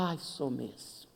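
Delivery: put-on soft, slow little old lady voice; a humble old woman sighing yes; lightly voiced frail old lady; meek old lady. Old lady answering meekly.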